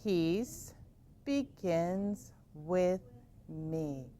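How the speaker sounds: slow mantra. Shown as a demonstration. Teacher guiding kids through the mantra slowly and softly. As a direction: breathe each word out slowly. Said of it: "Can you say it with me".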